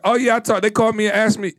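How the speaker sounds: mimicked advice-seeker. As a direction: imitate someone casually name-dropping.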